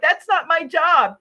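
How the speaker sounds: a laughing mock of a lazy employee; dismissive brush-off. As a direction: play the bored employee who won't help.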